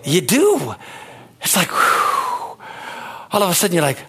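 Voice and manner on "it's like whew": airy high whew exhale; relieved whew. Pretend. Describing detox from the world, relieved airy whew. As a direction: breathe out the relief.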